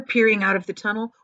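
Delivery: ordinary delivery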